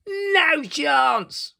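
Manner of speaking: very high, loud cheeky no chance; the three pigs jeering together; pigs' chorus. Acted. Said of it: "chorused the pigs".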